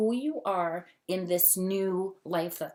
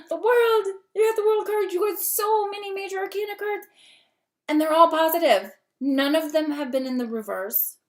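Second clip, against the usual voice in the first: gasping, much higher and louder, thrilled; real excitement; 10 semitones higher, 7 dB louder than usual. Real, not acted. Tarot reader gasping at the World card for her viewer, thrilled. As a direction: blurt it out like you just struck gold. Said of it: "I'm getting all excited".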